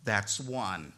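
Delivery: low, soft, ominous warning; quiet warning. Retold or imitated. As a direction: drop it low and calm, a threat. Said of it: "She looked quietly at me and she said".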